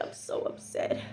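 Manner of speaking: soft whisper; hammed-up fragile whisper; upset friend. Friend on the phone confessing, whispered.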